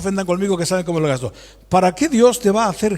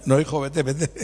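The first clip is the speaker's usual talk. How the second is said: lower, laughing shoo-off; laughing dismissal; 6 semitones lower, 5 dB quieter than usual. Retold or imitated. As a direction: send him out while laughing. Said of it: "Lo echas a la calle".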